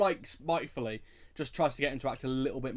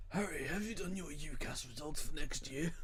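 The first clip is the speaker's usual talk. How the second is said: impression of Richard Harris; 3 semitones higher, 6 dB quieter than usual. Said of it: "impressions of Richard Harris".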